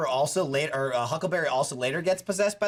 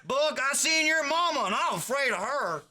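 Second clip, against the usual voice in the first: mock hillbilly joke; 8 semitones higher than usual. Hunters joking about Buck's mom.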